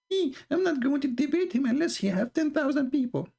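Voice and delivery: high, far-off mock voice of the rival; mocking imitation. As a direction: sneer his excuse in a silly high voice. Mocking the opponent's excuse.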